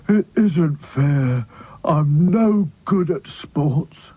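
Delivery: very slow, sulky grumble in Sad Sack's voice; grumbling. Acted. Sad Sack sulking after losing the race, slow grumble. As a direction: drag every word out in a sulk. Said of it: "grumbled Sad Sack".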